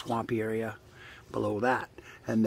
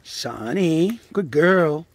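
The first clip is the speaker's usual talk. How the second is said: loud praise; 4 semitones higher, 9 dB louder, much slower than usual. Real, not acted. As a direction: cheer the dog on. Praising the dog.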